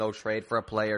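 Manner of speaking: usual speaking voice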